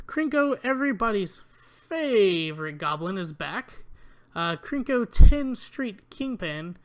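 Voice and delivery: loud, rising hype for Krenko; a big hyped reveal of a favorite goblin; excited announcement. Real, not acted. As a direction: announce him like a returning champion.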